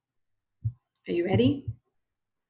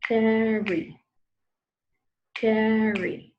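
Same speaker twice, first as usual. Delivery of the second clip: syllable demo; 4 dB louder, much slower than usual. Shown as a demonstration. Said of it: "Let's see the verb carry".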